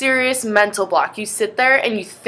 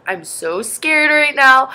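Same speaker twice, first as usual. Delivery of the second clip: higher, slow, mildly fearful; mock scared; 6 semitones higher, slower than usual. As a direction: stretch it out, half-joking fright. Telling trainers she's scared.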